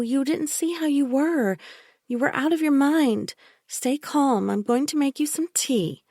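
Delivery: a sickly-sweet caretaker who won't be argued with; Bella, falsely soothing. A narrator's reading. Bella insisting to the narrator that she hurt Michael, falsely soothing. Bella voiced as a higher figure.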